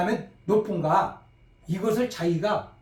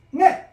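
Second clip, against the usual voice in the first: 네 cut short, high and falling; short sharp falling ne; 8 semitones higher, 7 dB louder than usual. Shown as a demonstration. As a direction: snap out 네 like a quick yes sir. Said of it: "짧고 끊어서".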